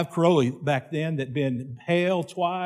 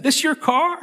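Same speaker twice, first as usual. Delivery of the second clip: amazed question; 13 semitones higher than usual. Retold or imitated. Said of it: "and I said".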